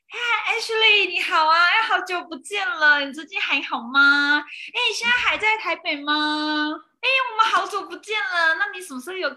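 high, sugary catch-up voice, slowed down; imitating her own eager, too-sweet hello to an old friend; overly friendly greeting. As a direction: sweeten it up like a long-lost friend. Re-enacting sweet reunion call to old friend.

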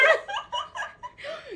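very high giggle falling away; high shrieking laugh. Real, not acted. Guest shrieks laughing at her spike joke.

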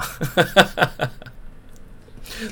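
breathy high giggle; high laugh. Real, not acted. Vlogger laughing at her own cheeky question, high giggle.

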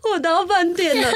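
laughing through tears. Retold or imitated. Reporting to friend while crying.

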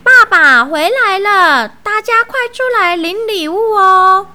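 high, loud call to the household; loud shout. A narrator's reading. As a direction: shout the good news for all to hear. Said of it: "大声呼喊".

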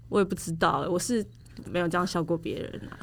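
ordinary talk, nothing marked

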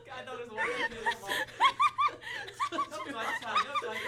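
high fast giggly talk; can't stop laughing. Real, not acted. Giggle fit while recording. (边笑边说)

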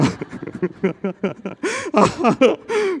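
high, long rising laugh; big laugh. Real, not acted. Preacher laughing at the father's call, delighted laugh. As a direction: burst out laughing at the punchline.

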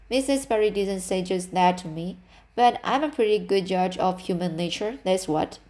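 plain reading voice

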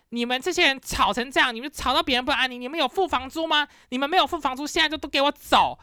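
mock furious telling-off; retelling her angry rant at the party guests; retold yelling. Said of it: "我就指着他们大骂".

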